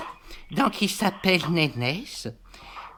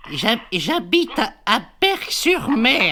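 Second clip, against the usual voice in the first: higher, louder, slower so the caller hears; louder repeat; 6 semitones higher, 6 dB louder than usual. Acted. Old lady repeats her town to the volunteer, louder and slower. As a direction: repeat it up and loud for a bad line. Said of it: "j'ai pas entendu ce que vous m'avez dit".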